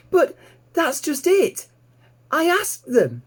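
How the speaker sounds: put-on high, bright young piglet voice, thrilled; piglet Babe, delighted. A narrator's reading. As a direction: squeal it out like an excited little pig.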